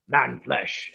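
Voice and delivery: loud orc relish. Acted. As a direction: say it like an orc smelling prey. Quotes orcs smelling prey.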